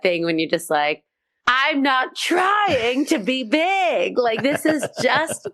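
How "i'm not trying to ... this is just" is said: big, high, drawn-out comic wail; hammed-up exasperated cry; over-the-top protest. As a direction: protest theatrically, stretching each word out high and slow. Comic protest at being too much.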